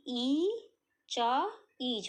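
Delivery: slow, syllable by syllable; slow drawn-out blending demo; sounding out slowly. Shown as a demonstration. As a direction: stretch each sound, then blend. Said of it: "पढ़ना कैसे है?".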